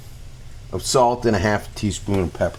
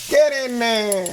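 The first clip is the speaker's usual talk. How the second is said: high coaxing; 12 semitones higher than usual. Acted. Tossing food into pan.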